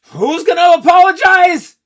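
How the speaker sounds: high, loud furious roar. Acted. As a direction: roar back in defiance. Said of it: "Ace roared".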